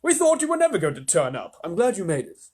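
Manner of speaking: grasshopper voice. Acted. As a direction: welcome him warmly. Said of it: "the old green grasshopper said".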